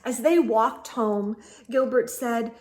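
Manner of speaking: usual speaking voice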